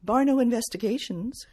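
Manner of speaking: a touch of polished receptionist; professional phone voice. Acted. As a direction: answer like a poised office pro. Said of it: "said in my most professional voice".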